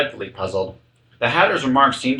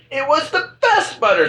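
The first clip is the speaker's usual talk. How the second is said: high, timid little voice; a small high meek creature; meek March Hare. A narrator's reading. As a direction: squeak it out meekly, very high. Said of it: "the March Hare meekly replied".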